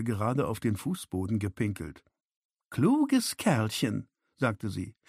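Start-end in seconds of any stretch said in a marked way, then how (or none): 2.7-4.1 very slow, higher-pitched mock praise; condescending, stretched-out sneer; sarcastic. A narrator's reading. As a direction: draw out the compliment mockingly. Sarcastic put-down of the questioner.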